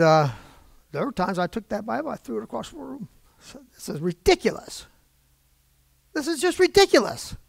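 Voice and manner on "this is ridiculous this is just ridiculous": retold exasperated outburst, jumping high on the repeat; worked-up exasperation. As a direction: act out getting fed up with the book. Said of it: "I can get pretty worked up".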